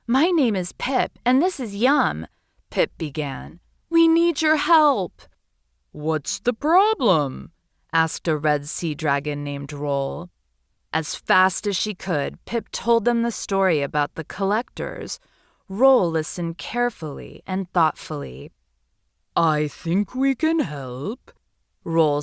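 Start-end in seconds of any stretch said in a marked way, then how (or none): 0.1-2.3 small high voice, earnest; a little seahorse pleading her case. Acted. Seahorse introduces herself to sea dragons. 3.9-5.2 high and louder, urgent little voice; pleading seahorse. Acted. Seahorse asks sea dragons for help. 5.9-7.5 slow, calm dragon voice; sea dragon voice. Acted. Sea dragon answers seahorse. 19.4-21.3 slow, calm dragon voice; sea dragon voice. Acted. Sea dragon answers seahorse.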